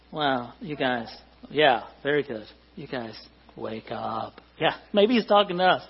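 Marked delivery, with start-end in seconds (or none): 2.8-4.4 low, slow, very soft; a limp, barely there wake-up call; sleepy half-hearted wake up. Acted. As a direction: murmur it low with no urgency at all. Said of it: "is that how you usually say it".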